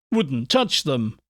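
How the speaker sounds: usual speaking voice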